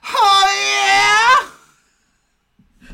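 huge, high, drawn-out victory yell; triumphant scream. Retold or imitated. Mom imitating daughter passing in Mario Kart, full shout. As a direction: scream it like overtaking in a race. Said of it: "pass somebody and they go".

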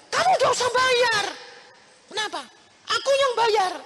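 high loud insistence; insistent friend. Retold or imitated.

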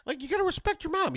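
very high, exasperated retold lecture; pissed-off dad. Dad lectures kids about mom, retold exasperation. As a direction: re-enact a dad's heated telling-off. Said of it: "I was so pissed at them".

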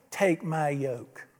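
soft, low and very slow; gentle invitation. Acted. Preacher quoting Jesus to the congregation, gentle invitation. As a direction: invite them in slowly and softly.

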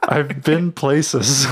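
slow, laughing through the words. Real, not acted. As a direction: laugh out the line slowly. Laughing confession.

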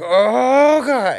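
high, loud, strained "Oh, God"; hammed-up freakout groan; mock anguished cry. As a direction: wail it like someone losing it. Guy writhing on DMT.

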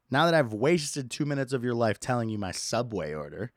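ordinary talk, nothing marked

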